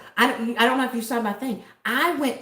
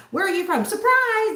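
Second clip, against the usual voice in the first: high-pitched question, louder gleeful answer; spring the punchline high and loud; goofy acted exchange; playful surprise; 11 semitones higher, 4 dB louder than usual. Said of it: "just every time I".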